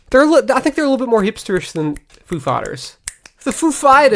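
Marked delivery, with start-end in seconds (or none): 3.4-4.2 a hint of Christopher Walken's halting cadence; lightly voiced Christopher Walken; Christopher Walken impression. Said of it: "That's my Christopher Walken impression".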